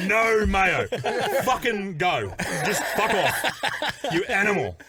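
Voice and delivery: high, loud, laughing through the insults; cracking up quoting the monk; giggly mock outburst; laughing retelling. Monk's outburst retold laughing.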